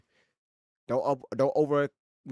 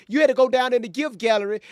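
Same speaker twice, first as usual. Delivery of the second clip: usual speaking voice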